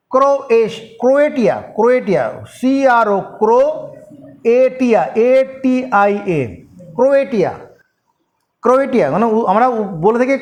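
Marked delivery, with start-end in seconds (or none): none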